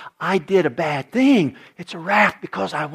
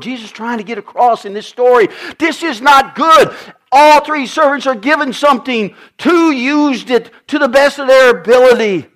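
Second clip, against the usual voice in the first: ordinary talk, nothing marked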